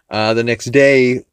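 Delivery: normal talking voice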